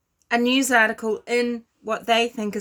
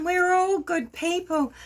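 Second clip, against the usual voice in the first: higher, sugary mock self-praise; mock sweet reassurance; 8 semitones higher than usual. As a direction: coo it in a fake saintly voice, higher. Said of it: "You'll be like".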